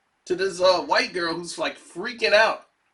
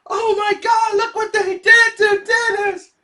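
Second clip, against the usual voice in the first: much higher, sing-song wail; mock wailing; 7 dB louder than usual. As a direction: wail it like a dramatic partygoer. Partygoers wailing over Dennis.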